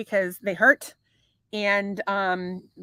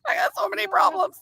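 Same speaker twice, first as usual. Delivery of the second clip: mock wail; 10 semitones higher than usual. Joking lament about her body.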